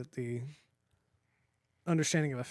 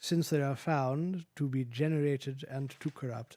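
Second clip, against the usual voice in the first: British accent. Shown as a demonstration. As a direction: hold the British accent through it. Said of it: "with a British accent".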